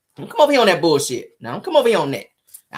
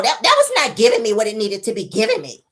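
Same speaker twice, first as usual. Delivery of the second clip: female voice; 2 semitones higher than usual. Shown as a demonstration. Mel telling Martell, woman's voice. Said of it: "I gotta say it in male voice".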